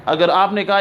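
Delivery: ordinary talk, nothing marked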